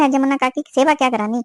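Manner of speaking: ordinary talk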